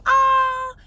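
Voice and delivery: short, stunned gasp of a word; surprised. Retold or imitated. Reacting to man refusing.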